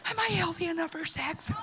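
high, small pleading voice; hammed-up sheepish question; mock timid patient. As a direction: ask the doctor in a silly high voice. Mocked patient asking doctor.

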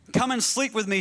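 acted insistent command; demanding. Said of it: "She actually demanded".

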